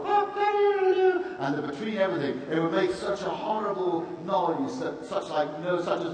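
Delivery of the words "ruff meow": very high, loud mixed animal cries; high barking and mewing; animal noises. Retold or imitated. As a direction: jumble barks and mews together. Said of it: "and the cockroach to go".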